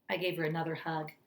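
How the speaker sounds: plain reading voice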